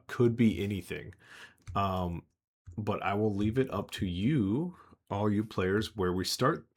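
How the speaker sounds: ordinary delivery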